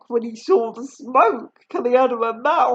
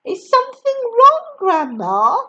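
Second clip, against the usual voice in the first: higher, polite question; polite George; 11 semitones higher, 5 dB louder than usual. A narrator's reading. George asking Grandma, higher polite voice. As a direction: ask sweetly, all innocence. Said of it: "George asked her politely".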